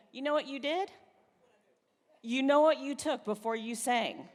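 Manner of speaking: ordinary delivery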